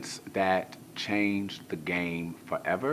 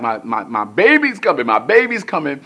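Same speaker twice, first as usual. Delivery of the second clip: panicked yell; 8 semitones higher, 15 dB louder than usual. Retold or imitated. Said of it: "So we were screaming".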